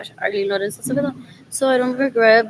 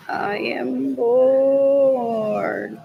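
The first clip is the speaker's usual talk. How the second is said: drawn-out bored; much slower than usual. Real, not acted. Bored alone on camera, a very slow drawn-out drawl. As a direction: stretch every word, very slow. Bored musing while browsing.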